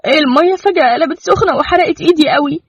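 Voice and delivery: high, pained complaint close to tears; tearful. A narrator's reading. Girl telling him she burned her hand, tearful. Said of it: "وماسكة إيديها بقلم وقالت بدموع".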